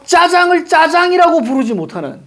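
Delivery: high-pitched, melodramatic parody of a grievance; mock lament. Parodying Hong Gildong's lament. (과장되게 한탄하는 높은 목소리)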